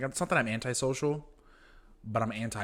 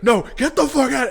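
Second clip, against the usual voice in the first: much higher and louder mock yell; mock furious yell; 13 semitones higher, 13 dB louder than usual. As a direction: shout him out of the house. Drunk Tad yelling.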